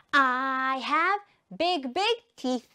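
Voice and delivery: drawn-out "I have", then punchy words; slow repeat-after-me delivery; slow model sentence. Shown as a demonstration. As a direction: chant it slowly for learners. Teacher chants with pupils.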